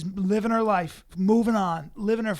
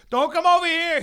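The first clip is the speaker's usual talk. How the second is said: loud high warning yell; hammed-up loud warning to the mom; mock shout; 11 semitones higher, 10 dB louder than usual. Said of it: "And he goes".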